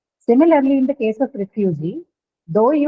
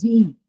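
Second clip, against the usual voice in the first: "G" said as a long, stressed "jee"; "G" as the stressed syllable with a long ee; stressed long vowel. Shown as a demonstration. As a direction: lean on "G" and hold its vowel. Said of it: "syllable which carries the long vowel".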